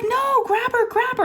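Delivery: very high-pitched mock frantic plea; retold screaming. Daughter begging mom to catch hamster, screaming. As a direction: imitate a kid squealing orders. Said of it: "she starts screaming".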